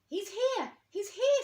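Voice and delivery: high, loud excited squeak. A narrator's reading. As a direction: squeak it out in delight. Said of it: "squeaked Rabbit".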